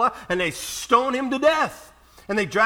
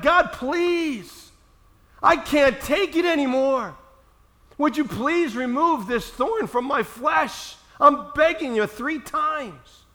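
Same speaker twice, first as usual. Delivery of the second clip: pleading upward, higher and slower; desperate plea to God; 5 semitones higher, slower than usual. Retold or imitated. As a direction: beg God to lift the burden. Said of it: "he cries out to God".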